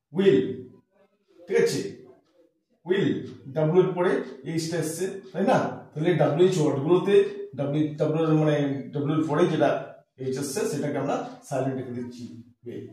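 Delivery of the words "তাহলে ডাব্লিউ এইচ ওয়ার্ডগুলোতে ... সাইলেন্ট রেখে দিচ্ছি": usual speaking voice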